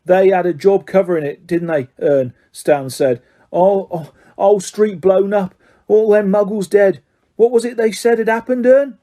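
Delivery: lightly voiced eager Cockney conductor; Stan's voice. Stan recalls the cover-up.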